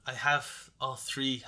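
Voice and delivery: normal talking voice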